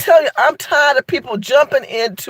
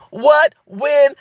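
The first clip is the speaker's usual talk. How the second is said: usual speaking voice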